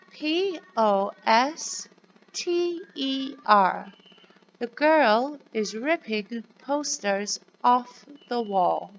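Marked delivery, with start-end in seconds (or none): 0.1-3.9 put-on slow, clear letter-by-letter spelling for children. Shown as a demonstration. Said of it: "can you say it with me".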